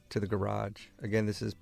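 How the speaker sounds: usual speaking voice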